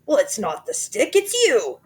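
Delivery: put-on high loud gloating old man; grinning horribly. A narrator's reading. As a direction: gloat with a nasty grin. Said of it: "grinning horribly".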